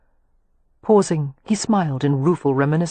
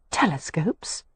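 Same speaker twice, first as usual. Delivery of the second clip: softer, puzzled one-word question; bewildered; 7 dB quieter, slower than usual. A narrator's reading. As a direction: ask it in quiet bewilderment. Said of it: "she asked in bewilderment".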